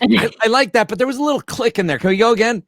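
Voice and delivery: high, loud fussy director voice; mock director. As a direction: play the picky studio director. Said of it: "imitating game director".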